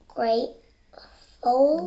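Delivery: Graceful said slowly and carefully, hard s in the middle; corrected word, slow. Real, not acted. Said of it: "Not grateful, gray".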